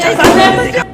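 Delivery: high, loud shout of silent; teacher losing patience with the class, loud high shout; shouting silence. Acted. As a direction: yell silent at the noisy class.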